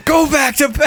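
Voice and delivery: high mock yell; Sophie yelling. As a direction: shout it like a fed-up housemate. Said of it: "I can hear Sophie yelling".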